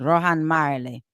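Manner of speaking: put-on slow Jamaican accent. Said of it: "trying to get my Jamaican accent".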